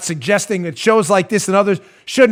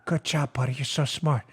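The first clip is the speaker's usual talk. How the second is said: mock whisper; 5 semitones lower, 10 dB quieter than usual. Mocking consultants praising each other.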